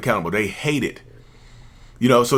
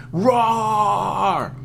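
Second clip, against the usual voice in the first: long, high mock roar; 7 semitones higher than usual. Narcissist raging at your tears, mock roar. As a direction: draw out a hammed-up roar.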